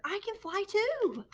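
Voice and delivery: high, slow, thrilled voice; excited little bat. A narrator's reading. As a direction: beam with pride as you say it. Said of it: "thought Stellaluna".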